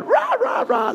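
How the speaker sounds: very high imitated "rah rah" guffaw; mock raucous banter. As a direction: squawk it like mocking rowdy laughter. Imitating castmates' raucous laughing banter.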